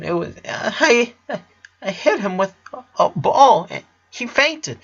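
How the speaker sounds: put-on high stammering little-kid voice, halting then rushing; a scared child blurting out a confession, stumbling over words; guilty child. Child confesses hitting brother.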